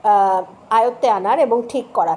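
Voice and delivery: ordinary talk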